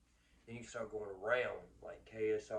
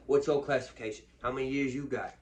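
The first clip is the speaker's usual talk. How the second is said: loud prison questioning; 2 semitones higher, 9 dB louder than usual. Retold or imitated. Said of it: "dude going around".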